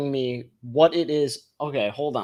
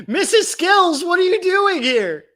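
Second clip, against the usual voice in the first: mock surprise; 13 semitones higher than usual. Repeats the mock greeting higher.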